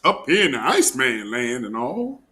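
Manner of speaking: ordinary talk, nothing marked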